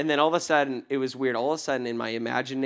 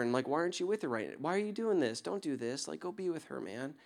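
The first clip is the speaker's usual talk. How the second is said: softly urging, almost under the breath; gentle pleading; 10 dB quieter than usual. Retold or imitated. To the stranger about his daughter, hushed gentle plea. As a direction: coax him home, hushed and tender.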